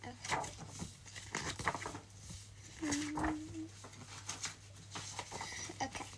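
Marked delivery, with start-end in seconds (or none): none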